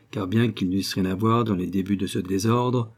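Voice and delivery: plain reading voice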